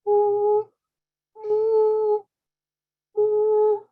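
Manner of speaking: high, loud droning "ooh"; freaky vibrating hum held on one high note. Shown as a demonstration. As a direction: drone an eerie, buzzing ooh. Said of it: "vibrating hum ... that sounds like".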